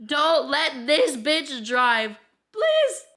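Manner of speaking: slow, loud, word-by-word order, leaping high on please; emphatic plea. Acted. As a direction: beg them slowly and forcefully. Imagined doctor's note, acted as plea.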